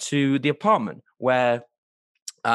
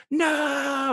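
much higher anguished shout; Chandler's anguished cry; 13 semitones higher than usual. Retold or imitated. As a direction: cry out at the paper cut. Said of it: "he goes".

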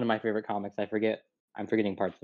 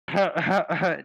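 forced huh-huh-huh chuckle, pitched up; badly faked celebrity laugh; Seth Rogen; 6 semitones higher than usual. Shown as a demonstration. Retrying the impression for a doubtful friend, put-on staccato laugh. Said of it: "Nope, that's not Seth Rogen".

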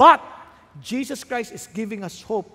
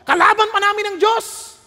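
loud, rising pulpit preaching; fiery sermon climax; preaching at full force; 7 semitones higher, 8 dB louder than usual. Acted. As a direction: hammer it home to the congregation.

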